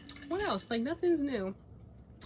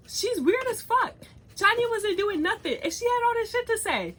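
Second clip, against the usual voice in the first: much higher, louder indignant rant; heated rant; 11 semitones higher, 4 dB louder than usual. Real, not acted. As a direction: vent it up high and fired up. Ranting about a roommate.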